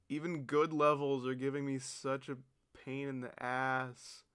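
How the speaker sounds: normal talking voice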